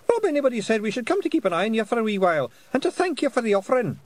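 high, loud Scottish pixie; squeaky little Scots fairy. A narrator's reading. Pixie figure voice.

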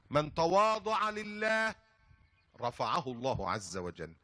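slow solemn preaching of a maxim. Acted. Preacher urging the congregation to humility, slow and solemn. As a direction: draw out each word like a sermon.

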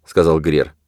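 plain reading voice

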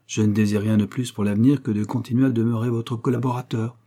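ordinary delivery, nothing marked

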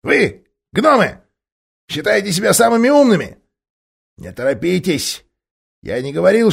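high, loud ape voice; scheming ape baiting them; sly ape. Acted. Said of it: "обезьян сказал".

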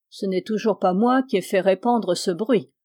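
ordinary delivery, nothing marked

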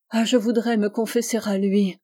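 plain reading voice

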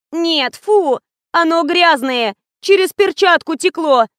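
high, disgusted whine; whining. A narrator's reading. Fatima complaining to the director about dirty milk, high whine. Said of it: "ныла Фатима".

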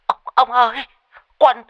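very high, loud, panicked wail; stammer, then scream the terrible news in panic; shrieking. A narrator's reading. Tea-seller phones a father about the accident, shrieking. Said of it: "Mụ rú lên".

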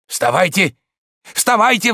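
high, loud, urgent alarm; frightened. A narrator's reading. Servant faking emergency to wake master, frightened. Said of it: "вдруг испуганным голосом заговорил он".

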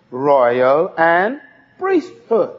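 ROYAL and PRIESTHOOD drawn out very slowly as two separate words; slow, separated words. Shown as a demonstration. Preacher has the congregation repeat ROYAL and PRIESTHOOD, slowly separated. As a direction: say it slowly, stretching ROYAL, then setting PRIESTHOOD apart. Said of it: "Now say it slowly".

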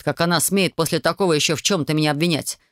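ordinary delivery, nothing marked

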